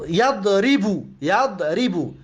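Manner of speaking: slow یضربو as yad-ri-bu; یضربو split into its syllables. Shown as a demonstration. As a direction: sound out یضربو with a on the first syllable.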